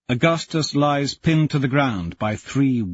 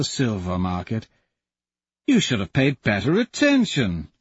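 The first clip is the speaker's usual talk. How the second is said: gloating jackal; 5 semitones higher than usual. Acted. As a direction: savour the cruel reveal.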